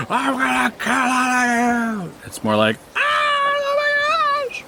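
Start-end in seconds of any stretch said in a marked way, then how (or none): 0.1-2.1 high, drawn-out mock war cry; mock murderous scream. Shown as a demonstration. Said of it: "Not like, not like". 3.0-4.6 very high, drawn-out mock scream; mock panic scream. Shown as a demonstration. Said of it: "it's panic screaming".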